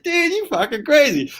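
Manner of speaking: very high, squeaky mock disbelief; mock incredulous. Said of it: "we just burst out laughing".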